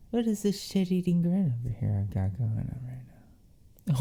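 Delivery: whispered, amused self-mockery. Real, not acted. Noticing her own grin, she whispers, amused.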